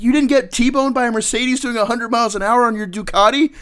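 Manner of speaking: very high, loud disbelieving squawk; baffled first sergeant. Retold or imitated. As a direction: shriek it in shocked disbelief. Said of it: "He's like".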